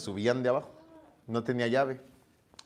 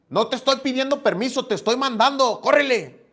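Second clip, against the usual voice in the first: put-on higher, ordering the kid to run; bossy older brother; 9 semitones higher, 3 dB louder than usual. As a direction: bark the order like a big brother. Hermano mayor manda a la tienda. (hermano mayor mandón, más agudo)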